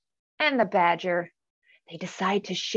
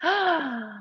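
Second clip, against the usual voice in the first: dramatic gasp; 6 semitones higher, 3 dB quieter than usual. Acted. Storytelling gasp.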